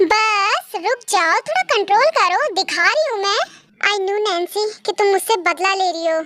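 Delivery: ordinary talk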